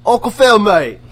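loud, high mock British call; British accent. Calling Uncle Phil as a joke, loud mock British accent. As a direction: punch it out in a hammy British accent. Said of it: "That was a terrible British accent".

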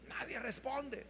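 usual speaking voice

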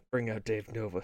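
soft laughing repeat. Real, not acted. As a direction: laugh it out softly.

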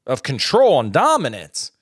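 normal talking voice

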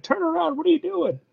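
high panicked squeak; mock-panicked high voice; flustered yelp. Flustered guy quoted in anime retell.

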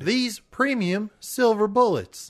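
high, slow product pitch; hammed-up salesman reveal; home shopping host. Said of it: "sent us a recording".

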